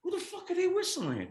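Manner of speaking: high squeaky bafflement; baffled. Retold or imitated. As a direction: ask it in high-pitched confusion. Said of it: "We're like".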